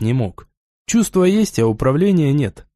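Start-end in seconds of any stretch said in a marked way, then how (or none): none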